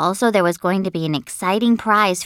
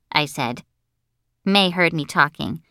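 ordinary delivery

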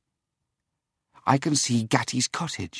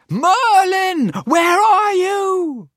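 very high, loud, slow cry of alarm; desperate shout; 8 dB louder, much slower than usual. Acted. The boy cries out for missing Merlin, loud and anxious. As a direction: cry out for him, stretching every word. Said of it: "I shouted".

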